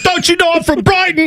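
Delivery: high, squeaky mock English bragging; English accent. Hooligan boasting of his town in a mock English accent. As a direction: puff up about Sheffield in a silly English voice. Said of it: "It's not a bad English accent".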